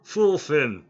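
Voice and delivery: loud bellowing call of a name; shouting. Acted. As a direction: roar the name across the field. Minotaur calls out to player.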